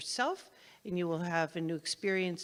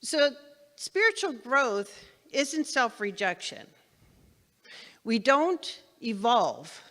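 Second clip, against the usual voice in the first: normal talking voice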